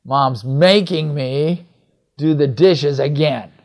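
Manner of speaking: slow, with making and again leaned on; stress on making and again. Retold or imitated. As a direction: drag out Mom's making me, lean on again. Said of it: "there's something about making and again".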